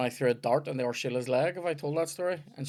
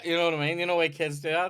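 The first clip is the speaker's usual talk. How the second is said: normal talking voice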